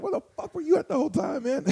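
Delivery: high squeaky mock protest; very high, quick playful whine; mock complaint. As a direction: rattle off a pretend grievance way up high. Mock complaint to the security guard.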